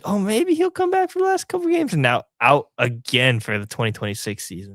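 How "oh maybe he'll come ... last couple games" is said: much higher, hopeful inner voice. Retold or imitated. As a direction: lift it way up, wishful.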